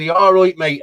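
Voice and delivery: loud, high call across the crowd. Retold or imitated. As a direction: shout the greeting over to him. Retells calling out to man.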